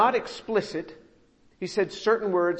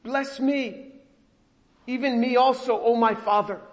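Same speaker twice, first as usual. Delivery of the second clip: pleading cry; 6 semitones higher, 3 dB louder than usual. Retold or imitated. Esau's repeated plea acted.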